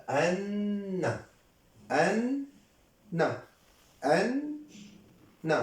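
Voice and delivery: "أن" drawn out and rising, then a short "ن"; doubled n split. Shown as a demonstration. Teacher separates the two nuns for students, drawn-out split. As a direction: hum the n of "أن", pause, release "ن". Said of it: "النون الأولى أن، ساكنة".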